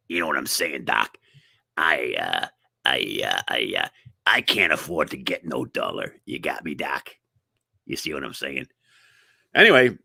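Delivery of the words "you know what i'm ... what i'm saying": very low, soft dopey voice; low, dopey mock-dullard; dim-witted patient. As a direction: play a dull fellow talking to his doctor. Dull slow patient answering doctor.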